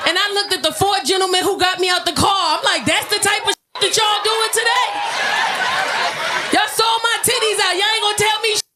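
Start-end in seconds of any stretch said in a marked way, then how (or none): 2.9-8.6 indignant shout. Retold or imitated. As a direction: call them out at full volume. Scolding the four firemen.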